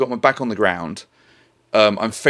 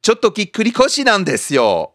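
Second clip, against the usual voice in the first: mock excuse; 11 semitones higher, much faster than usual. Mock excuse in role-play.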